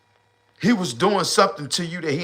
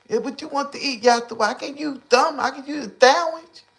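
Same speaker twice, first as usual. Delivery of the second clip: much higher, sugary mock woman's voice; mock sweet woman; 10 semitones higher than usual. Woman playing nice asking what her man wants, high mock voice. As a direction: play her acting nice for food.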